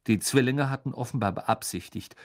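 ordinary delivery

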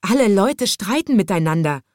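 loud, high despairing complaint. A narrator's reading. Conductor reports the quarrelling passengers, despairing. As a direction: throw up your hands in despair. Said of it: "sagte der Schaffner verzweifelt".

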